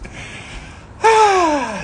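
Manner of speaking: sharp high gasp from the cold; shaky exhale. Real, not acted. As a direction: breathe out hard after the cold. After cold dunk.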